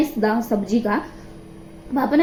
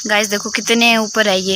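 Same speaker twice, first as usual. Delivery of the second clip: usual speaking voice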